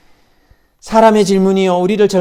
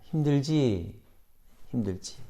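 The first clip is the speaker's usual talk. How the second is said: tender mother; 8 dB quieter than usual. Retold or imitated. Mother holds her daughter's hand, soft and tender. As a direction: soothe her as one who knows the pain.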